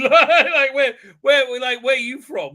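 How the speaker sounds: laughing through the words, high and loud; helpless laugh breaking into the line; real laughing. Real, not acted. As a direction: crack up while quoting the question. Cracks up while retelling dialogue.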